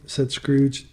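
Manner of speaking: ordinary delivery, nothing marked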